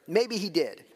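ordinary talk

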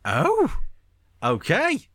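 high-pitched, taken-aback reaction; mock surprise, swooping up high; acted surprise. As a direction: re-enact a puzzled double take. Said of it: "So it's like".

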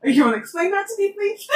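pergunta mais aguda, meio cobrando; Bella exigente. Retold or imitated. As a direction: cobre a explicação com atitude. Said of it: "E ela fica". (Bella cobrando Edward, tom agudo e atrevido)

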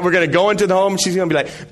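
ordinary talk

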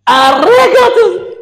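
loud, high, stretched yell cut short; screaming outburst. Retold or imitated. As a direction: screech the order at the husband. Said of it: "פתאום להתפרץ". (צרחה קטועה)

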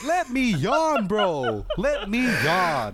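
high, drawn-out complaining whine; mock exasperated plea. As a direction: whine it out slowly, fed up. Retold plea, high and slow.

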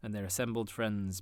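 plain reading voice

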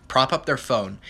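ordinary talk

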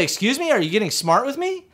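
high-pitched indignant challenge; imitated judge taking offence; affronted judge. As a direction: dare him to talk back. Judge challenges him for answering.

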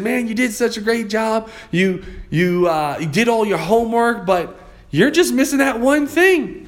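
high-pitched imitation of a Southern coach; Southern accent. Coach telling his student what is missing, in a Southern accent. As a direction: stretch it out in a put-on Southern twang. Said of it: "he had a little Southern accent".

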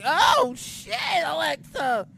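shocked reaction. Retold or imitated. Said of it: "I was like".

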